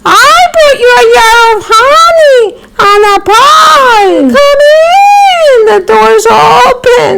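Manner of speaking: high put-on girlish voice; wolf squeaks to pass as the girl; wolf faking high voice. Wolf at grandmother's door fakes the girl's high voice. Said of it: "Putting on a high voice".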